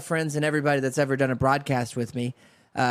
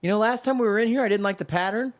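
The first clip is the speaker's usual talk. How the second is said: higher, faster, chatty shopper voice; shopping couple chatter; 6 semitones higher than usual. Retold or imitated. Wife musing over pillow covers at World Market, chatty and higher. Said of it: "walk around like".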